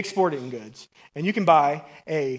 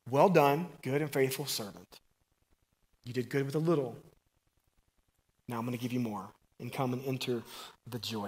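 soft blessing; 4 semitones lower, 6 dB quieter than usual. Acted. Same blessing, softer.

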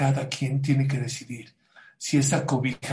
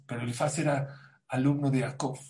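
ordinary delivery

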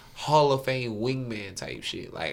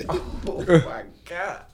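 high laugh, drawn out; 7 semitones higher, 5 dB louder, much slower than usual. Real, not acted. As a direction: laugh through the words. Laughing at his own story.

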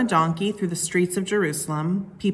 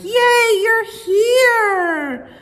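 much higher, louder excited cheer; joyful cheer; 7 dB louder, much slower than usual. Acted. Crowd greeting Jesus at the parade, high excited cheer. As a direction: squeal it like a delighted fan. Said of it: "some people were crying like".

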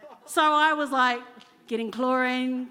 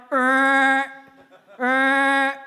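frog croak; 7 dB louder than usual. Retold or imitated. Imitating the frog behind the house, loud croak. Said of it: "I made the frog noise".

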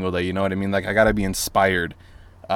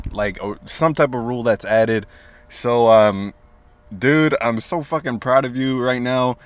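ordinary talk

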